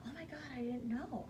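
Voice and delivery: soft, gasping disbelief; hushed shock. Retold or imitated. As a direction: breathe it out stunned. Gasped reaction to news.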